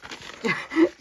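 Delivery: short chuckle at your own slip; amused laugh. Real, not acted. Crafter laughing at her own oops, amused chuckle. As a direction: laugh off a little mistake.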